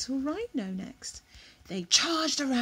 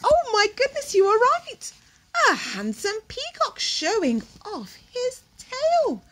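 much higher, much louder wonder; excited gasp; 11 semitones higher, 10 dB louder than usual. Real, not acted. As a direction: burst out in delight at the bird. Excited reaction to peacock.

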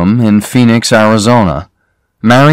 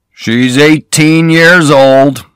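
put-on slow, leering old man, a little higher; greedy old man; 7 semitones higher, 3 dB louder, slower than usual. A narrator's reading. Cassidy showing off his daughter's photo, slow leering voice. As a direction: savour each word like a greedy old boaster.

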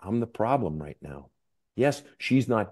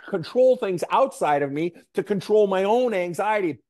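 high, strained acting out of rising panic; anxious, suffocating pitch, put on to illustrate; mock worked-up anxiety; 10 semitones higher than usual. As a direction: act out getting worked up, pitch climbing high. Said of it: "get worked up".

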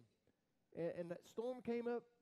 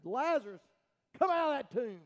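a booming call, louder and higher; loud command; 4 semitones higher, 10 dB louder than usual. Retold or imitated. Jesus calling Lazarus out of the tomb, loud command. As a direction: call the dead man out of the grave. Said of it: "Jesus cried out".

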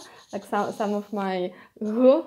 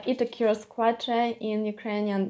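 ordinary talk, nothing marked

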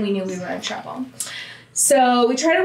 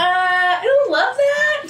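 high, drawn-out reluctance; hammed-up squeamish doubt; uneasy objection; 10 semitones higher, slower than usual. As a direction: retell a wary objection in a high, slow voice. Retold uneasy reply to bad plan.

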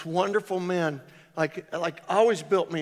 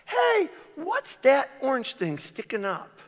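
high, carrying call from the porch; wife calling to husband under the mower, high and curious; wife's voice; 9 semitones higher, 3 dB louder than usual. Retold or imitated. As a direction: mimic his wife calling out, much higher. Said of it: "this is exactly how my wife talks".

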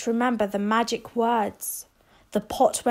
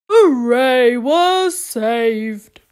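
louder jubilant cheer; 7 dB louder, much slower than usual. Acted. Townspeople cheering Rose for saving the town. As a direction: celebrate with relief.

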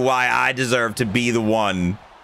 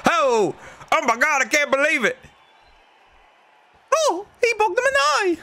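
much higher, stunned disbelief; shocked; 12 semitones higher than usual. Real, not acted. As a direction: gasp out the disbelief. Shocked reaction to game.

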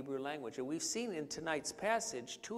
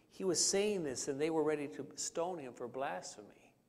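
ordinary talk, nothing marked

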